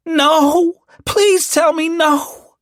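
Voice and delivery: high, loud, drawn-out wail; grief-stricken high scream; anguished scream. A narrator's reading. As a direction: scream in disbelief at terrible news. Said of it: "she screamed".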